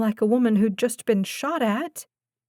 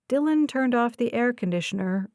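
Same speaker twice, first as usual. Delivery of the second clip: ordinary delivery, nothing marked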